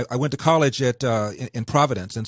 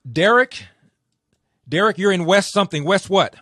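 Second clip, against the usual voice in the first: raised, higher call to get the caller's attention; 8 semitones higher, 6 dB louder than usual. Real, not acted. Host calling out to the caller on the line, loud and high. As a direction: call his name out across the line, up and loud.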